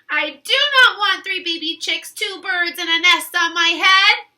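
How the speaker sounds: loud, whiny refusal; upset Elephant. Acted. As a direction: Elephant stamping his foot in words.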